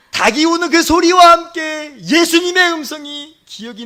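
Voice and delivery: loud, high preaching surge; full-force preaching. Real, not acted. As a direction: proclaim it at the top of your voice. (힘껏 외치는 설교조)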